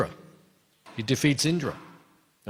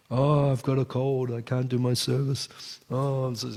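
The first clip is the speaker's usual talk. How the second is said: mock whining. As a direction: imitate someone moaning about being poorly. Mimicking a whining devotee's excuse.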